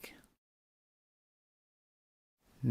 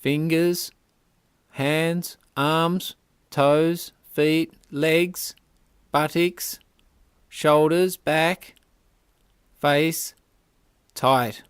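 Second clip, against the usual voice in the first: loud, raised one-word commands, each punched separately; forceful tensing cues; 3 semitones higher, 6 dB louder, slower than usual. Real, not acted. Yoga guide calls one last full-body clench, forceful tensing cues. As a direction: build the squeeze body part by body part. Final, strongest round of muscle clenching.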